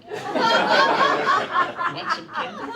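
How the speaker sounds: drawn out very slow, high and loud, luring; creepy candy offer. Retold or imitated. Comic imitating the park creep luring a girl, slow and loud. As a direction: stretch every word like a hammed-up creep. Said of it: "hang around the parks and say".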